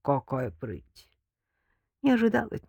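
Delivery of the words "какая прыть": slow, lower mocking drawl; unhurried sardonic amusement; smirking. A narrator's reading. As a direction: draw it out with a smirk. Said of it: "усмехнулся Артём Сидоренко".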